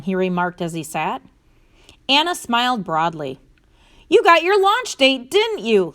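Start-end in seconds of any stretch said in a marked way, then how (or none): 4.1-5.9 high-pitched, eager exclamation; excited exclamation. A narrator's reading. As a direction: exclaim it like a thrilled guess. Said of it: "Larry exclaimed".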